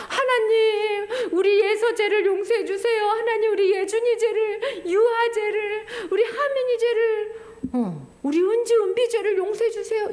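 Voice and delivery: high, tearful plea to God; tearful pleading prayer. Acted. Jesus pleading for each child's sins, high and tearful. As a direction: beg with a crying voice.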